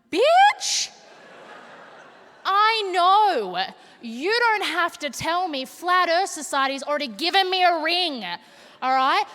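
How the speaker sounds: high, loud, defensive sass; sassy shout. Retold or imitated. Comedian retorting to her baby niece, loud and sassy. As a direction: snap back with mock outrage. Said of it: "I was like".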